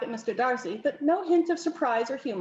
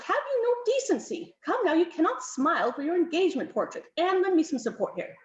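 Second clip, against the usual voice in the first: higher, scolding countess; admonishing countess; 7 semitones higher than usual. Acted. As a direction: countess scolding Darcy for smiling, mildly. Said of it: "the Countess admonished".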